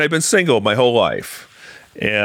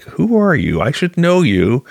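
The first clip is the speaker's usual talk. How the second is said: higher-pitched, imitated confusion; blank puzzlement; 8 semitones higher than usual. Camp director fails to recognize old colleague, high puzzled voice. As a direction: play someone struggling to place a face. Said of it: "she's got this blank look like".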